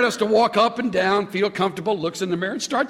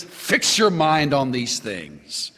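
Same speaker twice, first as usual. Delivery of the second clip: slow, weighty reading of the verse; weighty scripture quote read slowly; 4 semitones lower, much slower than usual. Shown as a demonstration. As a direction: lean on each word, slowly. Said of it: "Whatever is true and honorable".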